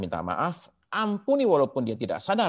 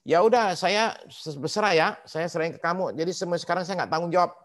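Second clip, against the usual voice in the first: mock careless excuse; 7 semitones higher than usual. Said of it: "Jangan bilang gini".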